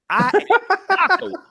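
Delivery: high-pitched mock protest; mock comeback. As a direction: squeal out the refusal in imitation. Repeats shopper's comeback, much higher.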